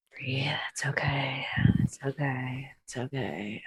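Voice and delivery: slow whisper, calming yourself down; whispered self-calming. Acted. Poppy talks herself down from punching the noble, whispering. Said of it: "I'm just like".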